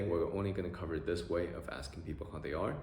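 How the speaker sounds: ordinary delivery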